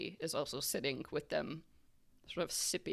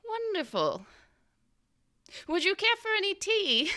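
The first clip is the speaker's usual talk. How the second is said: very high, singsong hostess voice; fluttery tea hostess; 3 dB louder than usual. Acted. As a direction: offer tea in a high, genteel lilt. Kathy offers tea.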